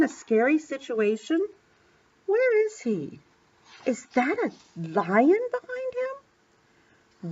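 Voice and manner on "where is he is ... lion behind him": put-on high, slow wide-eyed worry for a child; mock alarm. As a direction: draw it out with pretend dread at what you spot. Mock alarm at picture of lion.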